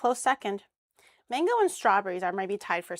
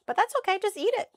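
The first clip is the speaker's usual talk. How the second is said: chirpy; 11 semitones higher than usual. Real, not acted. Cheerful reassurance.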